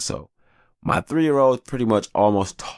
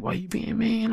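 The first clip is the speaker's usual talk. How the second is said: mock whiny kids; 4 dB quieter than usual. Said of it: "And they like".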